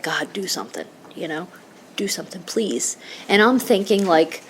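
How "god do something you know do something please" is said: softer, pleading prayer to God; quiet desperate prayer. Retold or imitated. Hugging her crying husband, quietly pleading with God. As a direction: pray under the breath, begging. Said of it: "quietly saying an Our Father".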